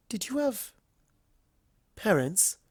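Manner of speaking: all polite charm, a little nervous; refined Rarity. Acted.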